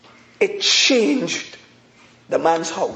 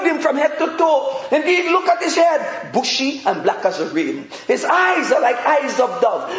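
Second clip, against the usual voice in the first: raised pulpit voice, bursts of laughter; lively preaching; 5 semitones higher than usual. Acted. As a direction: preach it with delight.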